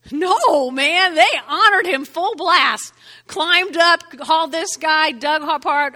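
loud, high, full-force answer; emphatic preaching. Real, not acted. Preacher answering his own question to the congregation, emphatic shout. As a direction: belt out the answer with conviction.

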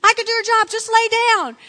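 high, loud eager imitation; mocking impression of pushy husband; cocksure husband. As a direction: push the offer, voice way up. Mimicking husband offering to deliver.

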